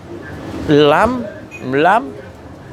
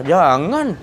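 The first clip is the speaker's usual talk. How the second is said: usual speaking voice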